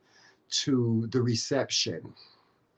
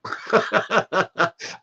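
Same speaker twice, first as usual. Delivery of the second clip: a choked, high laugh fighting tears; teary laugh; 8 semitones higher, 4 dB louder than usual. Real, not acted. Tearing up over her mother arriving, teary high laugh. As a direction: laugh it off before the tears come. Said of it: "I'm gonna start crying again".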